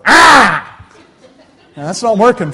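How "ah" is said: high, loud comic yell of a fighting sheep; mock sheep battle cry. Preacher mimicking a sheep fighting a wolf, loud mock yell. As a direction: squeal it like a sheep squaring up. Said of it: "put its little cloven hooves out and go".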